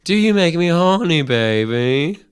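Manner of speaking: high, loud swinging playboy; Austin Powers voice. Retold or imitated. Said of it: "That was very good".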